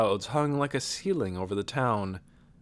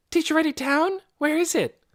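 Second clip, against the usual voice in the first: put-on high, loud, excited girl's voice; jumping up, urgent to know; eager girl; 5 dB louder than usual. A narrator's reading. Nikki jumps up eagerly.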